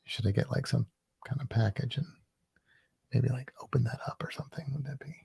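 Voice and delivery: soft breathy ASMR whisper. Shown as a demonstration. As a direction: whisper close to the mic. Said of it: "A S M R if I do that?".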